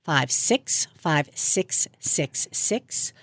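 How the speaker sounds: unhurried, digit by digit; slow, careful dictation. Real, not acted. Host asks how to call, she reads her number slowly. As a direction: spell out each digit slowly so they can write it down.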